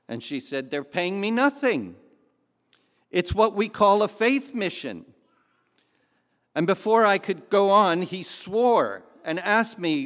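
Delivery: loud, lifted book reading with real conviction; dramatic reading aloud. Acted. Preacher reading a missionary's story aloud, loud and stirring. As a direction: read the testimony out boldly to the congregation.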